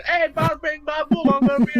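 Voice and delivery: hammed-up Brooklyn accent, high and loud; loud Brooklyn wise guy. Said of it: "That has a very Brooklyn accent".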